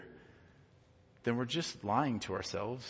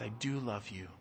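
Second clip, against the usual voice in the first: tender reassurance; 3 semitones lower, 8 dB quieter than usual. Retold or imitated. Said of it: "we can respond with".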